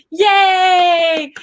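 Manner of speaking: high, loud joyful yay; cheering yay. Real, not acted. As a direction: cheer her on. Cheering for a viewer.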